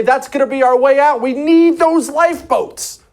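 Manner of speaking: acted-out urgent insistence, pitched high. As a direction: insist as those in charge. Voicing officials in his story.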